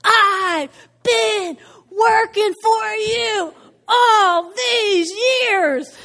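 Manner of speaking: slow, high, wailing shout; hammed-up outburst, every word drawn out; letting loose. Older son unloads on his father in a wailing shout. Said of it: "the son just lets loose".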